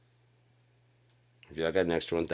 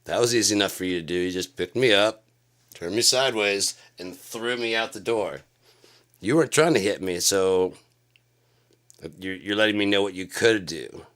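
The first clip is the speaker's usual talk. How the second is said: raised confronting voice; 3 semitones higher, 8 dB louder than usual. Retold or imitated. Confronting the bully, retold.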